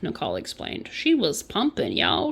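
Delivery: usual speaking voice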